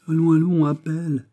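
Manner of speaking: high, loud, frowning command; impatient king. A narrator's reading. King ordering the duke to fetch someone, impatient. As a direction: call out an impatient royal order. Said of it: "fronçant ses sourcils noirs".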